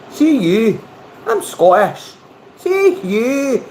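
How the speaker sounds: high-pitched, hammed-up Scottish accent; mock Scottish accent. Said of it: "We'll practice the Scottish".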